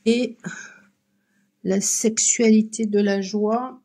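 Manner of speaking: ordinary talk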